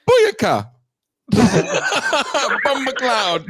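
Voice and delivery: loud, high Jamaican dancehall shout; over-the-top Jamaican patois exclamation; Jamaican accent. Shown as a demonstration. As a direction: holler a hammed-up Jamaican catchphrase, breaking into laughter. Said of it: "my Jamaican accent is just going".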